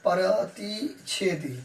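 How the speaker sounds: very slow, one syllable at a time: प्रतिच्छेदी; slow dictation. Shown as a demonstration. Teacher writing the Hindi term for students, slow dictation. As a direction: spell out प्रतिच्छेदी slowly while writing it. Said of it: "Hindi में भी मैं लिख देता हूँ".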